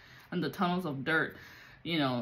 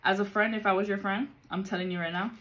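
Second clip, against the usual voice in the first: normal talking voice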